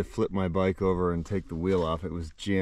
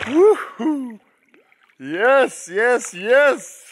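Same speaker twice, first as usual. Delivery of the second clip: high, loud whoops of joy; excited cheering; 9 dB louder, much slower than usual. Real, not acted. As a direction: cheer it out, thrilled. Water is perfect, cheering.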